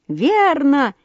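high, mild cheer; lightly called-out agreement; crowd calling out. A narrator's reading. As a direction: chime in as an approving crowd. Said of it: "крикнули все хором".

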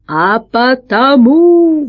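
very drawn-out, ominous answer; slow, mock-terrible menace; menacing. A narrator's reading. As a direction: stretch it out like a threat. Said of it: "грозно ответил маленький катастрофист".

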